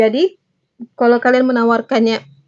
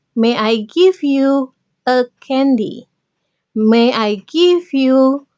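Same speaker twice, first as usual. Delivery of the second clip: slow, clear teacher model of each question; slow model sentences; 2 semitones higher, much slower than usual. Shown as a demonstration. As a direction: spell it out slowly for learners. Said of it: "Izinkan saya memberikan kamu apa misalnya". (contoh kalimat diucapkan pelan dan jelas)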